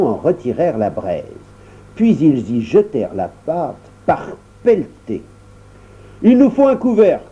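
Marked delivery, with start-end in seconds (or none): 6.2-7.2 much higher, brisk orders; the baker orders the dwarves about, much higher; commanding baker. Acted. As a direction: direct the crowd of dwarves.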